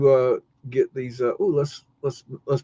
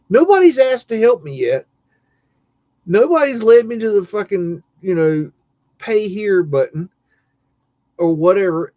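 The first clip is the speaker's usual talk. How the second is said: loud, high-pitched exasperation; indignant outburst; 6 semitones higher, 5 dB louder than usual. Retold or imitated. Shopper fuming in his head at the clerk, indignant. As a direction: burst out in disbelief, louder and higher.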